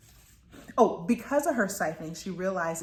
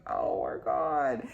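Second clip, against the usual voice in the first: exasperated; 6 semitones lower, much slower than usual. Retold or imitated. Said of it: "I'm like".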